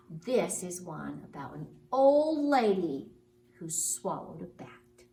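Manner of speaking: ordinary delivery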